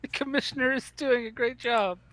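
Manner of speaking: lightly robotic, high canned repeat; bot voice. Pretend. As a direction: parrot the line like a chat bot. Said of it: "We actually got bots in the show".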